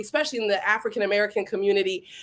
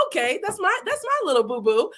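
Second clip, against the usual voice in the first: usual speaking voice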